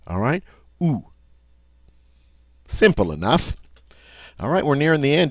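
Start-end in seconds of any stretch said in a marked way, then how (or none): 0.8-1.1 "Ou" said oo as in two; short separate "Ou"; oo sound. Shown as a demonstration. Teacher repeats French sound, oo as in two. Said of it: "Like the double O in the word two".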